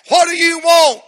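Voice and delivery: high, loud demand from the pulpit; preacher's shout. Retold or imitated. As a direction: bark it at the boy, hard. Preacher confronts him at altar.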